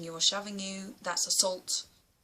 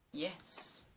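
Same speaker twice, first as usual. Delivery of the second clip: much softer, almost inaudible whisper; whispering the calm reply to a bully; whispered aside; 4 semitones lower, 13 dB quieter than usual. Pretend. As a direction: let it fade to a whisper. Closing advice, fading out.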